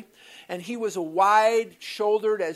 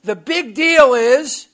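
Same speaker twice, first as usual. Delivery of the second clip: loud, higher, slowing mock yell; retold yelling; 5 semitones higher, 9 dB louder than usual. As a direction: build into a yell at him. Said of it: "he was yelling at me".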